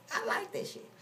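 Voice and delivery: normal talking voice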